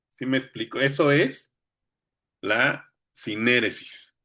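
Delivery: ordinary talk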